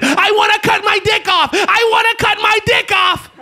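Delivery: high frantic scream; screaming madman. Retold or imitated. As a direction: scream it like a man gone mad. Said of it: "if a person's screaming it".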